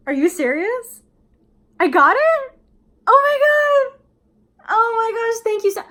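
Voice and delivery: very high and loud joyful shout; ecstatic. Shown as a demonstration. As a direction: scream with joy at the news. Boss says she got promotion.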